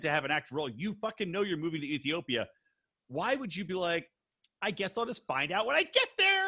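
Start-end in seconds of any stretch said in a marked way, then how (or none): 4.6-6.5 high mock-clueless voice, climbing at the end; hammed-up naive squeal rising through the line; Kenneth. Podcaster mimicking Ariella's lack of planning, high and hammed-up. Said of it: "Was that a Kenneth impersonation?".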